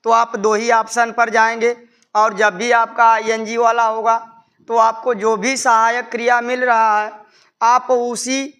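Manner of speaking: ordinary talk, nothing marked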